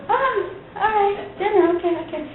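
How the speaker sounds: surprised acceptance. Retold or imitated. Pastor's wife retelling her reaction to the invite, surprised. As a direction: act caught off guard but agreeing, laughing. Wary reply to dinner invite.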